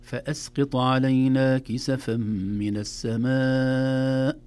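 loud slow chant, "السماء" held very long; connected madd. Shown as a demonstration. Teacher recites the example, "السماء" long chanted madd. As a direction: recite on a level tone, stretching ما in "السماء". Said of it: "الهمز في الكلمة والمد في الكلمة".

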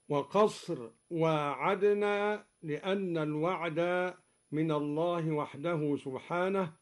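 normal talking voice

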